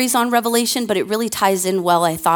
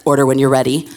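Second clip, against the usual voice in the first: lower, flat recorded prompt; second automated voice; 7 semitones lower, 4 dB louder, faster than usual. Retold or imitated. Imitating the drive-through speaker, lower. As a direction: drop down into a dull machine voice. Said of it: "a different voice that came on".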